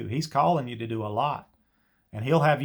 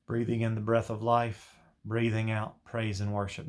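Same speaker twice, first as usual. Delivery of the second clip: guided meditation; 3 semitones lower than usual. Shown as a demonstration. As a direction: ease each phrase out gently like a meditation leader. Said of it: "You don't have to say it like I just did".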